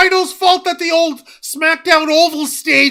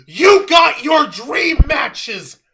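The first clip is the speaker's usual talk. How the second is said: ordinary talk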